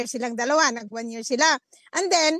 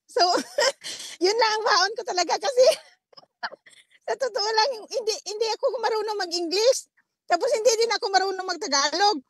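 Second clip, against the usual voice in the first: laughing; 5 semitones higher than usual. Real, not acted. Laughing at her poor English.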